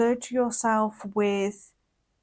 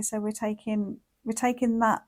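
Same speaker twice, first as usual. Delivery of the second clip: ordinary delivery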